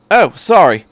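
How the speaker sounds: light apologetic Doctor; Doctor's voice. Acted. The Doctor apologises for shorting the tape player, breezy and high. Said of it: "the tall man said".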